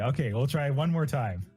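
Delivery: normal talking voice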